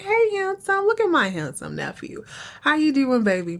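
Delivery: high-pitched, effusive hello on a retold quote; gushing greeting. Aunt greets her handsome nephew, gushing. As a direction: lay the doting auntie on thick, starting way up high.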